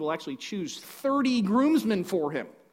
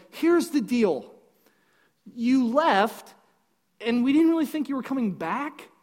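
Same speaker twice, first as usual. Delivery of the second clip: slightly acted dad, higher and spaced out; lightly voiced bride's dad; 6 semitones higher, 4 dB louder than usual. Dad blocking Samson at the door, lightly acted explaining. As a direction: break it to him in pieces.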